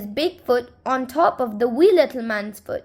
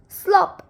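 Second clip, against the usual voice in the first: splashy slop; 7 semitones higher than usual. A narrator's reading. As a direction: pop it out like a splash. Sound effect in read story.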